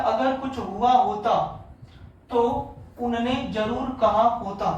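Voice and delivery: slow, word by word dictation. Shown as a demonstration. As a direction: read the sentence slowly for writing. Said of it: "लिखा हुआ है". (धीमा श्रुतलेख)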